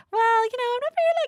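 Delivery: high, airy clueless voice; a girly voice that pleads ignorance; playing dumb. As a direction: act innocent and helpless about cars.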